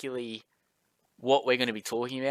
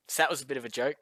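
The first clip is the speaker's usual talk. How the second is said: ordinary talk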